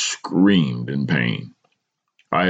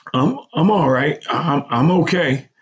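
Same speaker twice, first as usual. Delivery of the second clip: stammering reassurance, higher voice; stuttered; 14 semitones higher, 3 dB louder than usual. A narrator's reading. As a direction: insist you're fine while stumbling. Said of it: "I stuttered".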